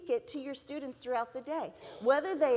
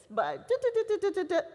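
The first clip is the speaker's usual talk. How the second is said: lightly voiced babbling speaker, trailing off; mimicking speaker's filler; 7 semitones higher, faster than usual. Telling the audience what the conference speaker said, mock babbling. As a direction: imitate the speaker glossing over the rest. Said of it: "I don't know why I'm saying this".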